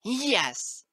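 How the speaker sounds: high, loud colt's shriek. A narrator's reading. Colt defying his threatening father, high shriek. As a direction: shriek it back defiantly. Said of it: "Snip shrieked".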